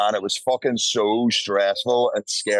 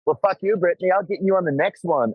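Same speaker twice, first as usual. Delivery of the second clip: mock sassy girl; 8 semitones higher than usual. Girl's jokey parting line.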